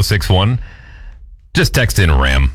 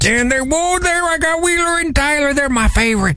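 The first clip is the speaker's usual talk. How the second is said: put-on high, wavering old-timer fiddling with the radio; old man voice; 13 semitones higher than usual. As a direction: play a creaky old man. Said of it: "from your old man impression".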